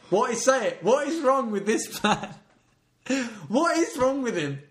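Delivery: high-pitched, incredulous exasperation; exasperated rant. Real, not acted. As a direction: despair at this man, pitched right up. Ranting at the columnist's absurd article.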